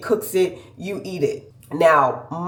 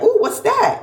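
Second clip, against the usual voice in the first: curious ooh. Retold or imitated. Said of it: "'cause I was like".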